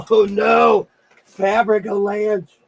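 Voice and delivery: surprised cry at a mess; startled oh no. Real, not acted. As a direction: react to the fabric pile falling. Fabric falls down.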